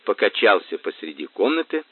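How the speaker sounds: usual speaking voice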